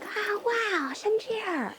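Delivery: high, soft mock wonder; hushed, high-pitched fake amazement; mock awe. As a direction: gawk like wide-eyed kids, way up high. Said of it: "And we were all like".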